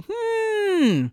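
usual speaking voice

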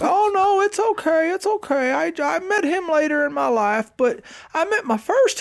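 very high, sing-song reassurance; cheery reassurance. Retold or imitated. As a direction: wave off the sympathy brightly. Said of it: "she's like".

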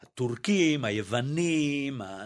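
usual speaking voice

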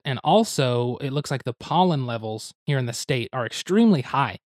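ordinary talk, nothing marked

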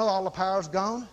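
low, level, drained delivery; low and emptied-out, imitating a dazed man; acted-out powerlessness. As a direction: act it out as if all the power has gone. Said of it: "I walk around like this".

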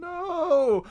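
high, drawn-out squeal of a no; exaggerated anguished no; mock outrage. Real, not acted. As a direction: wail it like you can't believe it. Dismayed protest at comic criticism.

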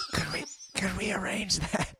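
strained, comic pleading whisper; mock whisper. Co-host playing him asking her, whispering. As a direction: whisper a timid request, hammed-up. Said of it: "Why is this whispering going on for so long".

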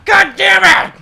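hammed-up furious shout, high and very loud; psychopath dad. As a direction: explode at the kids as a mock-raging dad. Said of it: "Just a psychopath dad".

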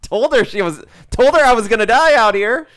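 loud, high-pitched acted cry; defiant yell. Doomed driver in the speeding van, defiant yell. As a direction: raise it to a hammy shout.

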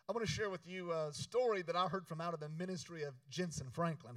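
ordinary delivery

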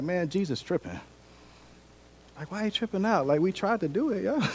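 bewildered grumbling. Retold or imitated. Disciples complain Jesus is tripping, high bewildered grumble. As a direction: act out disciples grumbling among themselves.

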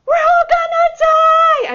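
high, loud, drawn-out mock scream; panicked yelling. Agitated student in the van climbing to the crater, imitated yelling. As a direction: yell it out like a terrified student. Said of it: "he was literally yelling".

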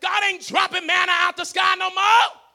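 loud, high, impassioned sermon shout; full-force preaching. Real, not acted. Preacher warning that harvest needs relationships, shouting. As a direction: drive it home at the top of the voice.

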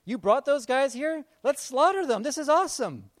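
high, loud gleeful voice; gleeful king. Retold or imitated. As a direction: play the eager king thrilled at revenge. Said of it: "So the king's like".

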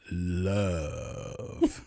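LOVE stretched into one very long word; drawn-out name. Acted. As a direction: hold LOVE for a long, teasing beat. Drawing out a nickname suggestively.

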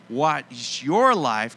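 "your" stretched out loud and strong; stress on "your". Shown as a demonstration. Preacher tells hearers it's not someone else's, stressing "your". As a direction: hit "your" hard, slowly. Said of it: "not someone else's".

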